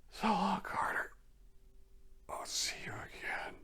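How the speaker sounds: barely-there whispered voice; faint whisper. A narrator's reading. Dying man whispering goodbye over a telephone line, faint. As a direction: whisper weakly as if fading away, voice catching. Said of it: "faint voice of".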